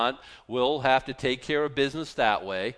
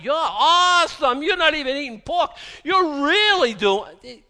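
much higher, louder, slowed gushing fake admirer; mock gushing fan; 13 semitones higher, 9 dB louder than usual. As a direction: ham up a starstruck fan fawning over the pious.

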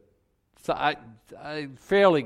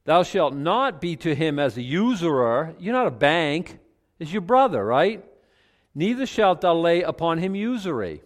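forceful preaching; 5 semitones higher, 5 dB louder than usual. Acted.

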